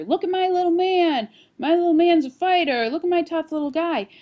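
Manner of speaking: loud, high proud bragging; proud parent cheer. Retold or imitated. Parent praising son's roughness, proud and loud. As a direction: brag about your tough little boy.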